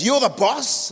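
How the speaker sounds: incredulous trucker on the line, high and loud; caller's voice. Retold or imitated. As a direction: play the pushy caller checking who he's got. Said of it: "he goes".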